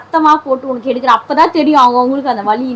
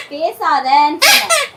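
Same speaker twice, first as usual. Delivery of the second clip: angry; 9 semitones higher than usual. Real, not acted. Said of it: "ஏய், கோவப்படாத".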